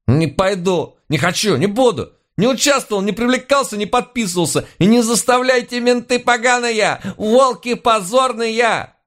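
very high, wailing tantrum of refusal; hysterical protest. A narrator's reading. Citizen refuses to go with the police, hysterical high-pitched protest. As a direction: build to a high, stretched-out cry of abuse.

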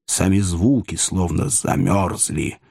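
usual speaking voice

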